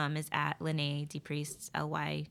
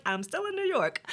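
mock apology voice; 11 semitones higher, 6 dB louder than usual. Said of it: "it was like".

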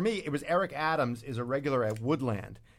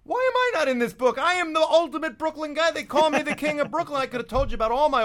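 mock outrage; 9 semitones higher, 5 dB louder than usual. Host mock-demands a place in book.

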